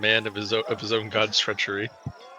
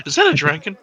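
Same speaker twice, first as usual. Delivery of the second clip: surprised; 5 semitones higher, 5 dB louder, faster than usual. Acted. Sudden alarm in game.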